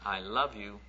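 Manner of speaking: soft, gentle repeat; sweet little girl. Retold or imitated. Little girl's love recalled softly. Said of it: "repeats the girl's words".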